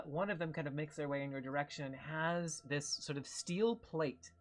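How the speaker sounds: ordinary talk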